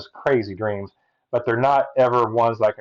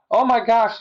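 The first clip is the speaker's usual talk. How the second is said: amazed gasp; 12 semitones higher than usual. Retold or imitated. Learning father was saved.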